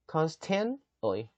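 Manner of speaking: constantly stressed on con, very slow; constantly with a heavy first syllable; stress demo. Shown as a demonstration. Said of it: "여기다 강세 한번 넣어주시면 돼요".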